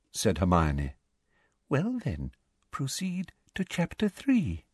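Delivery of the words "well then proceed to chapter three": Umbridge voice. A narrator's reading.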